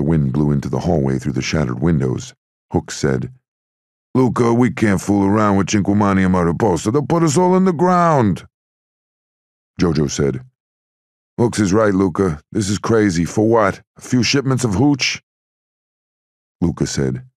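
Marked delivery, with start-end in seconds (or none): 4.1-8.5 high, urgent gangster voice; worried henchman. A narrator's reading. 11.4-15.2 higher-pitched, insistent gangster voice; protesting henchman. A narrator's reading.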